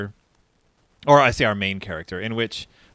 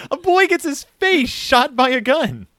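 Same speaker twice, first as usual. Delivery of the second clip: mock dramatic; 13 semitones higher, 5 dB louder than usual. Mock dramatic recap of violence.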